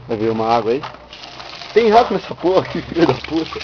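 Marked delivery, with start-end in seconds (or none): 1.7-3.6 startled outburst. Real, not acted. Spots a rat.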